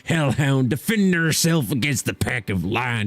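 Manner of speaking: put-on drawn-out, hammy pirate accent. Streamer reading out a summary of the drama, pirate voice. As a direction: read it like a storytelling pirate. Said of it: "summarized in a pirate voice".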